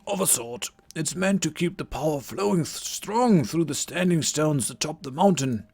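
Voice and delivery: put-on a theatrical old druid greeting; old wizard voice. Game master voicing Merlin greeting the Vikings, old wizard voice. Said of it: "the voice that I'm giving him".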